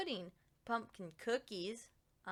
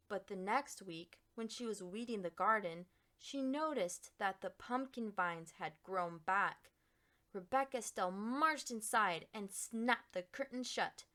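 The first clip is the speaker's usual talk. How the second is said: usual speaking voice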